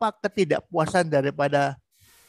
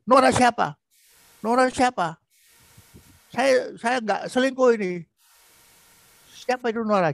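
higher, louder defensive outburst; emotional, loud; 5 semitones higher than usual. Shown as a demonstration. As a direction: fire back at her, voice up high. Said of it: "jangan emosional seperti itu. Jangan suaranya keras itu". (suami membentak istri dengan suara tinggi)

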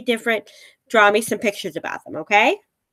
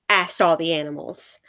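usual speaking voice

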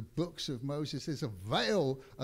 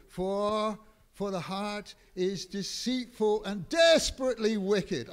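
loud, higher, hammering the verse; forceful scripture quote; 5 semitones higher, 7 dB louder than usual. Acted. Preacher to congregation, loud forceful scripture quote. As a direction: thunder out the warning.

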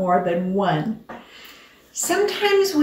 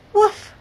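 dog woof; 12 semitones higher, 3 dB louder than usual. Acted. Dog in the story.

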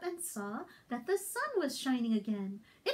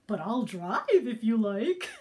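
laughing dog voice; 6 semitones lower, 6 dB louder than usual. Acted.

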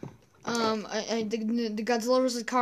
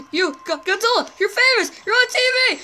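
much higher and louder, giddy; thrilled shouting; 9 semitones higher, 7 dB louder, faster than usual. Acted. Kong telling Godzilla he's on TV, thrilled and shouting. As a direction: squeal it out with glee.

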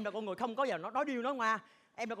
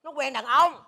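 loud blurted gossip; 4 semitones higher, 11 dB louder, slower than usual. Acted.

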